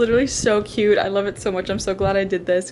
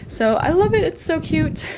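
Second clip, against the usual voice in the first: excited; 8 semitones higher, 5 dB louder than usual. Real, not acted. Gushing over new bookshelf.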